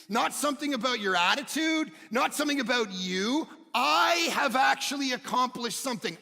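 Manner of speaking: high, loud, shouted preaching; preaching at full force. Acted. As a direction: drive it home to the church.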